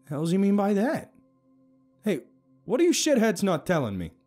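loud, high-pitched baffled demand; confused. A narrator's reading. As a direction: demand to know what's going on. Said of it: "I asked in confusion".

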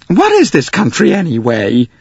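high, loud, tearful American lady; tearful demand. Acted. Missus Hubbard tearfully demanding of the passengers. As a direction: demand it near tears. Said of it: "demanded Missus Hubbard tearfully".

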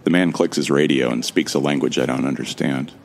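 normal talking voice

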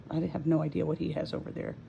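normal talking voice

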